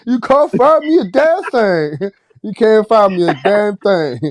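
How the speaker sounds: slow, drawn-out Texas drawl, laughing; mocking Texas accent. Teasing the Texans on the phone in a mock Texas accent. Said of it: "he mocking the shit out of us".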